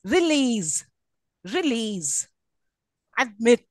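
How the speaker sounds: "release" and "admit" stressed on LEASE and MIT; release, admit, each with final-syllable stress; stress on last syllable. Shown as a demonstration. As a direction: lean on the ends of "release" and "admit". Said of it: "a verb always the last".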